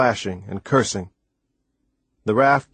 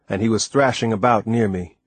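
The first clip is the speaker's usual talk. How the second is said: ordinary delivery, nothing marked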